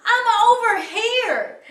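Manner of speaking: high, loud yell back. A narrator's reading. Jubal answering through blinding rain, yelling. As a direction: holler to a distant rescuer. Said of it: "he yelled".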